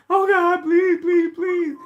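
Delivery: high, whiny pleading to God; mock begging prayer. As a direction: beg God in a high mocking whine.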